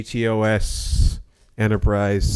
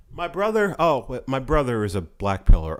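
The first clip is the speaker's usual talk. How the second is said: ordinary talk, nothing marked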